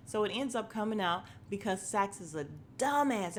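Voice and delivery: normal talking voice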